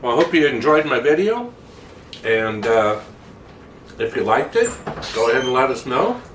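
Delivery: talking with a mouthful of cookie; mouth full. Real, not acted. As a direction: speak around a bite of cookie, mouth full. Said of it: "Sorry, I got a cookie in my mouth".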